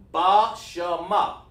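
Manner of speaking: BA, SHA, MA each as its own sound; separated syllables. Shown as a demonstration. Teacher tests students on connectors, syllables deliberately separated. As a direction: sound out BA, SHA, MA with breaks between. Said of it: "is that what it sound like".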